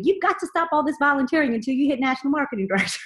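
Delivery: mock Southern upline telling her off; Southern accent. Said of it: "I gotta say it in her Southern accent".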